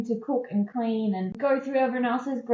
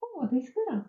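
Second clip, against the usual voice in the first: mild surprise; 7 semitones higher, 5 dB quieter than usual. Retold or imitated. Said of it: "you might go".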